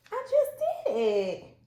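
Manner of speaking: high, loud laughing boast; laughing triumph. Real, not acted. Laughing triumph after success.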